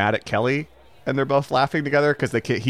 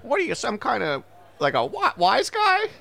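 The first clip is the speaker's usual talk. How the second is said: high squeaky stooge voice; Three Stooges voice; 9 semitones higher than usual. Retold or imitated. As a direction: do a squeaky Curly. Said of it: "Three Stooges".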